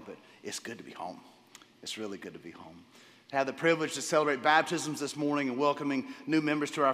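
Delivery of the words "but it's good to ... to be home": low, soft and sincere; heartfelt relief. Real, not acted. Pastor home from overseas to his church family, softly heartfelt. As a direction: settle gently into each word, voice dropped.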